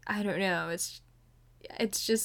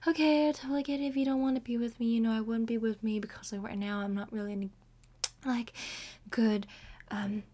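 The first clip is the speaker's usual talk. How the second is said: imitating boyfriend. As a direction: rattle off his excuse in a mock voice. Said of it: "he's just like".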